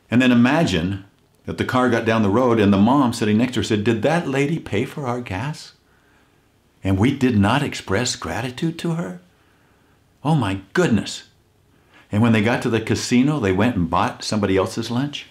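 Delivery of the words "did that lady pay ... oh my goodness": put-on higher, dismayed old woman's voice; a touch of a flustered old mother; surprised elderly mother. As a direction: realize with a gasp of shame you forgot to thank her. Elderly mother realizing in car.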